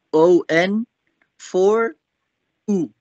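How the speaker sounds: normal talking voice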